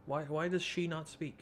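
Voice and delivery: normal talking voice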